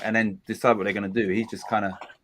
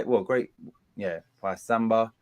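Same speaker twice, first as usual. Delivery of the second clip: normal talking voice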